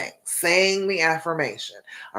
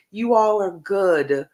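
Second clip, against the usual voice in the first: normal talking voice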